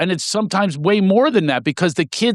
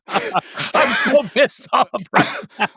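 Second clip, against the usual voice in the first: high, slow mock fury through giggles; drawn-out giggly fake rage; anger laughed out; 9 semitones higher, slower than usual. Shown as a demonstration. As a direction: say you're furious while laughing hard. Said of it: "say it out loud".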